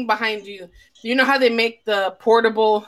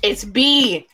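ordinary talk

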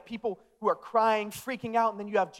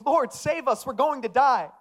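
raised, mildly alarmed cry for help; urgent plea; 5 semitones higher, 4 dB louder than usual. Retold or imitated. Disciples waking Jesus in the storm, acted urgent plea. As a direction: read it as an acted cry to be saved.

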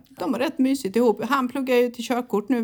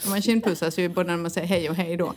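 normal talking voice

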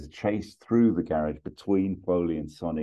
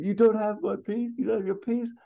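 high-pitched indignant shouting; acted shouted telling-off, rushing at the end; Axel Foley. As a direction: scold in an incredulous squawk, far above your own pitch. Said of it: "Axel just shouts at him".

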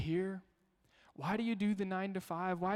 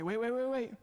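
higher, louder stop call; 6 semitones higher, 4 dB louder than usual. Retold or imitated. Dad stopping kids at dinner, louder and higher. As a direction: halt the kids before they dig in. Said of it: "I say".

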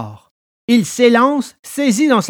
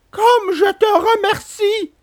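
much higher, slow thankful puppet voice; grateful puppet; slower than usual. A narrator's reading. Pinocchio thanking Alidor, high grateful puppet voice. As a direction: thank your rescuer in a small high voice. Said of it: "dit le pantin".

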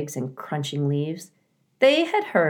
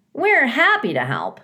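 higher, cheery weasel voice; smiling weasels; 9 semitones higher, 5 dB louder than usual. Acted. Weasels thanking the robot, smiling and higher. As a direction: chipper and pleased to help. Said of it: "said two smiling weasels".

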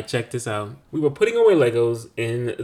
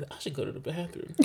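quiet realization; 16 dB quieter than usual. Retold or imitated. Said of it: "I'm like".

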